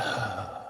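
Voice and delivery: long, soft sighing breath out; slow coaching exhale; demonstrated exhale. Shown as a demonstration. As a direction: let the breath go audibly.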